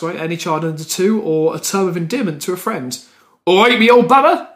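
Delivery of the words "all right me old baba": lightly voiced Gloucester yokel greeting; a hint of local accent, cheery. Shown as a demonstration. Showing how locals greet a friend, mild Gloucester accent. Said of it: "That kind of thing".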